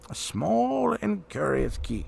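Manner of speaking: slow hushed reveal of a curious key; slow, mysterious. Acted. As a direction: draw it out like a secret. Gandalf produces the key slowly.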